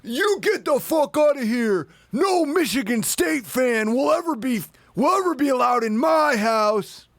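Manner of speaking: enraged host throwing a rival fan out, shouting; furious host shout. Retold or imitated. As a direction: yell him out of the house, pitched high. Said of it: "And the host goes".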